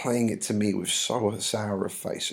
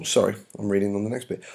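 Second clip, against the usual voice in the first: normal talking voice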